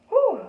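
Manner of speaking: big loud whoosh of an exhale, high; deep breath. Retold or imitated. Student on the school intercom exhales loudly into the phone. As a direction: blow out a huge relieved breath. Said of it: "took a deep breath on the phone".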